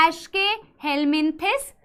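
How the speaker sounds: "एस्केहेलमिन्थिस" in slow parts, rising at the end; teacher breaking up "एस्केहेलमिन्थिस"; slow model pronunciation. Shown as a demonstration. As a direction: sound out "एस्केहेलमिन्थिस" for the class. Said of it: "क्या बोला जाता है".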